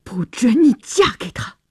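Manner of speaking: a touch of clenched-teeth anger, lightly voiced; through gritted teeth. A narrator's reading. George forbids his sister to marry Chris, through gritted teeth. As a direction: grind it out, understated fury. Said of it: "乔治咬牙切齿地说".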